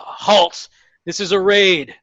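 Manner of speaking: a moderate barked command; captain's yell. Acted. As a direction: call out the halt with authority.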